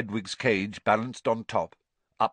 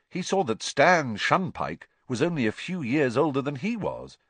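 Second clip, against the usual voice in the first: plain reading voice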